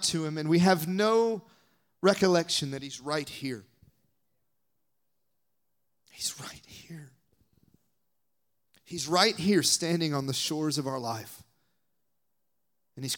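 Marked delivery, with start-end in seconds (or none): 6.1-7.1 slow hushed whisper. Real, not acted. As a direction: whisper it slowly, close in. Preacher whispers key line.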